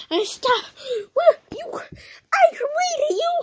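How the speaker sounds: high panicked voice. Acted. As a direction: shriek it in a tiny high voice.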